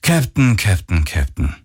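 mock-disappointed sigh, pitch stepping down; patronizing, sighed reproach. A narrator's reading. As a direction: chide with a weary falling repetition. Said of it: "ertönte es seufzend".

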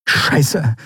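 exasperated oath; cursing. A narrator's reading. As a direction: curse in frustration. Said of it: "fluchte Andriel".

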